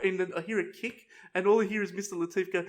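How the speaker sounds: normal talking voice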